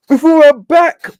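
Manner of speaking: slow, yawning words, voice pushed up high and loud by the yawn. Real, not acted. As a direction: yawn right through "before I'm back". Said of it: "Pardon me, I'm yawning".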